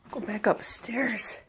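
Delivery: hushed whisper to the dog. Real, not acted. Whispering to the dog to go back upstairs. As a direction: breathe it so nobody wakes.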